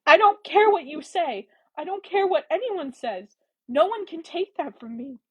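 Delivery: high, impassioned defiance; heated, wounded defiance; shaking with emotion. A narrator's reading. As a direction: insist with rising emotion. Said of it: "her voice shaking with emotion".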